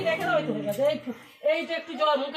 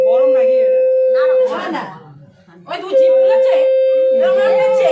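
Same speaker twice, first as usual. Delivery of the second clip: loud fussing; 9 semitones higher, 11 dB louder than usual. Real, not acted. Aunt fussing over hot food.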